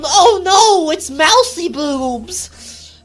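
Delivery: loud, slow, high nerd wail; hammed-up squeaky dismay; Bentley. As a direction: drag it out in nasal cartoon horror. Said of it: "I can do a pretty good Bentley".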